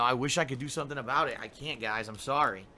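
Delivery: normal talking voice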